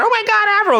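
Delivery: very high, loud excited shriek; squealing fangirl. Retold or imitated. Girls at the airport shrieking at the singer. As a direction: squeal like a starstruck teen fan.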